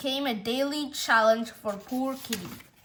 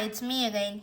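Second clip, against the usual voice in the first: usual speaking voice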